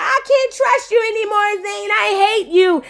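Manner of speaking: high, hammed-up heartbroken girlfriend; hurt girl. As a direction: pout out the breakup line. Girl storming off, voiced.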